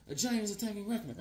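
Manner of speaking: lightly voiced hurried newsman; reporter. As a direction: rattle the scoop off under your breath. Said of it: "He's like a, a reporter".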